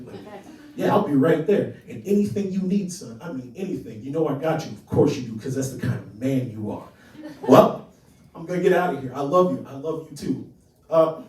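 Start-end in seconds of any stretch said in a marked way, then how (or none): 0.8-6.9 tense, emphatic affection, loud in bursts; half yelling. Acted. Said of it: "kind of yelling, half yelling". 7.5-10.5 loud, awkward phone goodbye; half-yell the loving sign-off. Acted. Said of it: "kind of yelling, half yelling".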